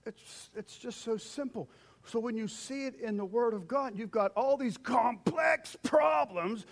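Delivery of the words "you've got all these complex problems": loud, slow, higher preaching; shouting from the pulpit. Real, not acted. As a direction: drive the point home with force. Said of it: "preaching climax".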